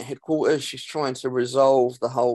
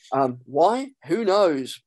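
ordinary delivery

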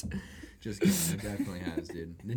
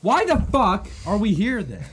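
outraged disbelief; 6 semitones higher, 10 dB louder than usual. Real, not acted.

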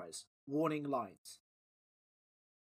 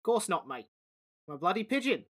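louder, cheeky Cockney pigeon; 2 semitones higher, 4 dB louder than usual. Acted. Pigeon denying it spoke to Dom, cheeky and louder. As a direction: deny it with a straight face. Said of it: "said the pigeon".

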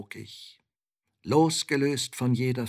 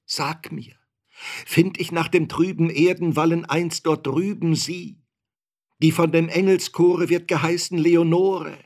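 high, loud, imploring declamation; anguished, insistent questioning; desperate pleading; 6 semitones higher, 6 dB louder than usual. A narrator's reading. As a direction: beg for an answer at full voice. Narrator begs raven about dead Leonore.

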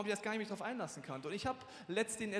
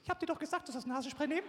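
nagging wife; 9 semitones higher, faster than usual. Retold or imitated. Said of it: "Meine Frau gibt dann immer so tolle Tipps".

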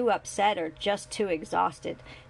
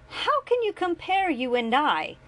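much higher, louder rebuke; angry rebuke; 10 semitones higher, 5 dB louder than usual. A narrator's reading. Julian to his father, angry rebuke. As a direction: turn on him sharply. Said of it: "Julian turned on him".

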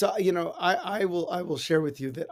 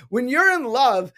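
ordinary talk, nothing marked

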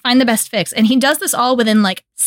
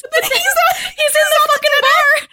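squealing glee. Real, not acted. Gushing to friends about Scotty sulking in the bar, high laughing squeal. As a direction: shriek it out through laughter, delighted.

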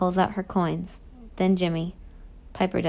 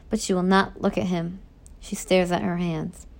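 ordinary delivery

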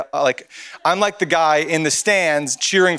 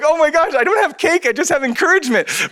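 excited; 11 semitones higher than usual. Retold or imitated. Joking about no cake.

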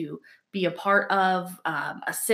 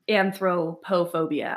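slow, enunciated anthropophobia; real slow; slower than usual. Real, not acted. Co-host having to enunciate a hard word, real slow. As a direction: enunciate every part of the word, taking it slowly. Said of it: "I'm gonna say it real slow".

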